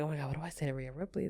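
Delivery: fast, hushed panicky thought to herself; panicked inner thought. Retold or imitated. Starstruck fan panicking beside Rhea Ripley, fast and hushed. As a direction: rush it under your breath, starstruck.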